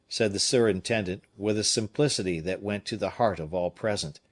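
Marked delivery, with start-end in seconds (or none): none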